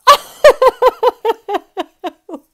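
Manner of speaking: very high, loud, long laughing fit; helpless laugh. Real, not acted. After cracking herself up, a long high laughing fit. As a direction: collapse into laughter. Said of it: "I'm cracking myself up".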